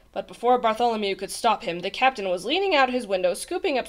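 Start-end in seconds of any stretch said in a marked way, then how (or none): none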